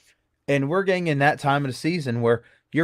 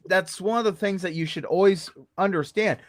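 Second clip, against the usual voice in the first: ordinary talk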